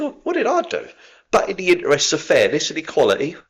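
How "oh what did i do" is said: high, rising oh no; mock dismay. A man catching his own gaffe, mock dismay. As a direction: pretend to be shocked at yourself. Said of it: "I just think".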